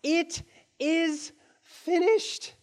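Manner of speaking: very slow, hushed declaration; solemn final words. Retold or imitated. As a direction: let the last words land slowly. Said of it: "What did Jesus say on the cross".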